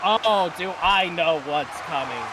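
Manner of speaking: wrestling commentator. Acted. Live wrestling play-by-play.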